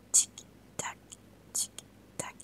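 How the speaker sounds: soft whisper, like a ticking watch; whispered tick-tock. Acted. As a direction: breathe it into the child's ear. Gendarme shows the ticking watch.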